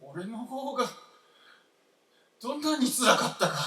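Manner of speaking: pained outburst, rushing the words; anguished outburst. Acted. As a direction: let the grief break out. Old man bursts out in grief.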